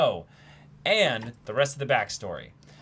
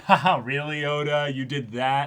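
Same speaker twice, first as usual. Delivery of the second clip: mock scoffing; 9 dB louder than usual. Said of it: "derogatory thing slightly of".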